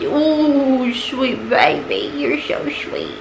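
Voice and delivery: whispering love to the cat; soft coo. Real, not acted. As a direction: hush tenderly at the kitty. Cooing at the cat.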